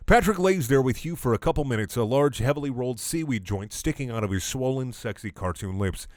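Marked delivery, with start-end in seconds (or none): none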